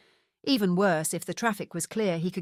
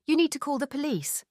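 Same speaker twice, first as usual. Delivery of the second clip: brisk; 8 semitones higher than usual. Acted. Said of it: "said Lady Camilla briskly".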